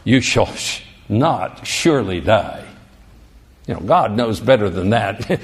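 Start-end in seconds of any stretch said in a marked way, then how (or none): 0.0-2.6 low serpent voice. Acted. Serpent tempting the woman, low sly voice. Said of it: "what's the serpent say?".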